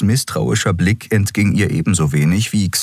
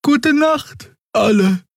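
very high-pitched creature goodnight; sleepy Draxül; slower than usual. A narrator's reading. Xea says goodnight to everyone, sleepy Draxül voice.

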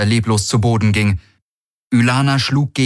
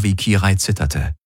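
ordinary delivery, nothing marked

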